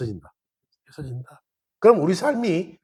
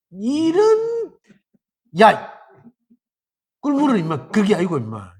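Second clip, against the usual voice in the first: scolding know-it-all; 2 semitones higher than usual. Acted. First-mountain person correcting a friend, angry shout. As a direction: snap at someone for the wrong word. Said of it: "첫 번째 산에서 어쩌노?".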